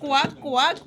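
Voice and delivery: quacking like a duck. Retold or imitated. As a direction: play a cartoon duck. Duck sound for child.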